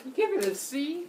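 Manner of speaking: surprised. Real, not acted. Disbelief at low grade.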